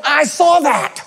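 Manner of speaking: high-pitched mock referee yell; yelled accusation. Referee pointing at player over a foul, mock yell. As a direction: call it out like a gloating ref. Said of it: "point his finger at you and yell".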